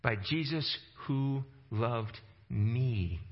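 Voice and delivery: slow, leaning on the word me; personal emphasis. Shown as a demonstration. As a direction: make me sound personal and slow. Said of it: "See the personal emphasis there?".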